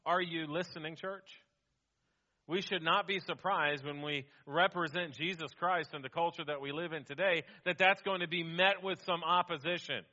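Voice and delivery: usual speaking voice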